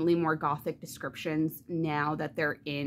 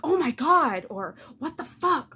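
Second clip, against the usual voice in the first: mock exclamations; 7 semitones higher, 4 dB louder than usual. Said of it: "instead of just like".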